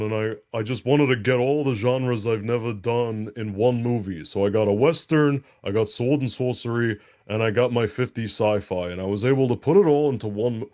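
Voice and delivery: lightly put-on thick New York accent. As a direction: quote him in a hint of New York. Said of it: "this real thick New York accent".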